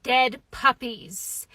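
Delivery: low, slow, soft deadpan; deadpan dentist. Retold or imitated. Dentist trying to stop patient laughing, low slow deadpan. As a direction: drop it low and grave, word by word. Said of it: "he just looked me in the eyes and he said".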